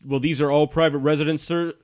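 a hint of an officious guard. Guard warning the wizard about private residences, stiff. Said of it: "He says".

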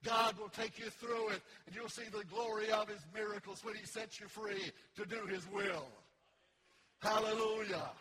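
loud, emphatic sermon delivery; full-force preaching. Real, not acted. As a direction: preach it with rising conviction, stretching the big promises.

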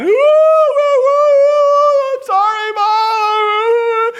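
very high, loud comic wail; hammed-up sobbing howl; mock crying. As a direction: bawl an exaggerated tearful apology to mom. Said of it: "and she's crying".

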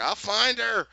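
high, loud, hammed-up promise; mock frantic shout. Scolded underling answering Glinda, mock frantic shout.

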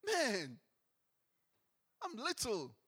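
a stunned man feeling small before God; Isaiah paraphrased, awed and overwhelmed; awestruck Isaiah. Retold or imitated.

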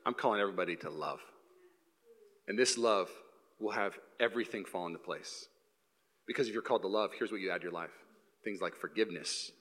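low, soft and unhurried appeal; tender, hushed low voice; gentle. Acted. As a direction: quietly invite them to love. Voices Jesus calling people to love.

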